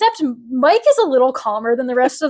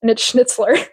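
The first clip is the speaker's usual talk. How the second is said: ordinary talk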